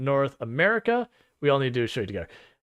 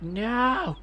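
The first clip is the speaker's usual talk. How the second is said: mock despair; 8 semitones higher, 5 dB quieter, much slower than usual. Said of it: "And so he's like".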